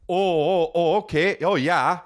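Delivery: lightly mock the Dakota way of talking; Midwest oh yeah. Comedian mocking how Dakota people talk, mild Midwestern accent. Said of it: "'cause that's how they talk".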